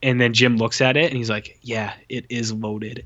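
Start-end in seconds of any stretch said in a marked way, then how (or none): 1.6-3.0 slow, soft, deadpan mockery of bad dialogue; mocking a clumsy action-movie line, slow and soft. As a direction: drag it out flat and soft, making fun.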